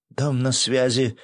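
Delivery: radio operator through static; crackly radio voice, slow; fading in through static. A narrator's reading. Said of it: "донёсся сквозь помехи голос радиста".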